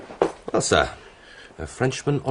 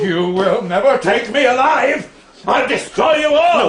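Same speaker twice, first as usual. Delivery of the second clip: raging, higher threat shouted out loud; furious shout; 10 semitones higher, 5 dB louder than usual. Acted. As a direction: bellow it at the whole room. Drunk man threatening with pistol.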